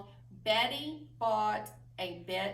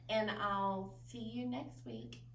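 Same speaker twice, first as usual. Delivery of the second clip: ordinary talk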